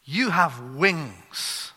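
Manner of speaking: booming, very slow preacher proclamation; hammed-up pulpit voice; duck preacher. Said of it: "the duck preacher is standing in front of the duck congregation saying".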